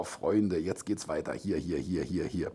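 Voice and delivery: low, very soft aside; muttering to himself. Real, not acted. Host hunting for the next item, muttering quietly. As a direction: mumble it under your breath while searching.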